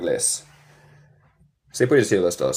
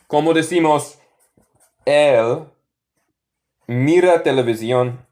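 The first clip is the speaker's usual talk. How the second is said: ordinary talk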